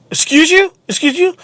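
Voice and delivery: high-pitched, rising offended question; mock indignant. Retold confrontation with the manager.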